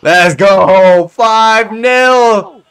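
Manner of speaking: loud, drawn-out victory yell; triumphant shout. Real, not acted. Gamer celebrating a fifth goal, triumphant shout. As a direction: belt out the score, holding the words.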